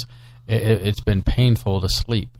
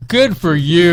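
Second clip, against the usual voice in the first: higher, louder sarcastic jab; mock retort; 8 semitones higher, 6 dB louder, slower than usual. Real, not acted. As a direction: throw it back teasingly. Mocking retort to wife.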